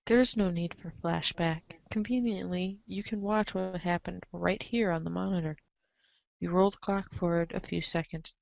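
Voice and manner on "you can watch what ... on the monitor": plain reading voice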